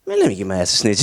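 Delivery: lightly voiced informant. Brother taking the plea, a low resigned mutter. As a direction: mutter it like a guy caving in. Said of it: "And brother said".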